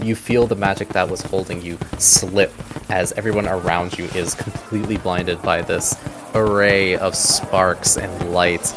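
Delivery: normal talking voice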